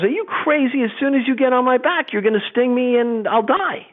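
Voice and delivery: a frightened frog refusing the scorpion; alarmed frog. Acted. Frog voiced in fable.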